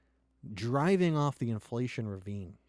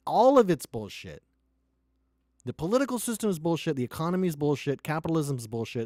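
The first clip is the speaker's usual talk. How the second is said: louder, heated ranting at the system; angry rant; 5 semitones higher, 6 dB louder than usual. Real, not acted. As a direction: shout it out, fed up and furious. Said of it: "I'm a little pissed".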